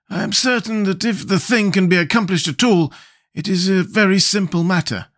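high-pitched character voice, explaining confidently; Scarecrow, self-assured. Acted. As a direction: lecture the others in a high Scarecrow voice.

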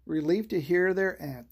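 usual speaking voice

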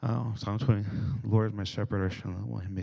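soft, absorbed self-talk; imitated murmured meditation; muttering under breath. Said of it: "to mutter under your breath".

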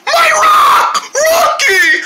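a sudden high, loud scream of panic; panicked shout. Acted. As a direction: shriek for the lost rock. Boy loses his rock.

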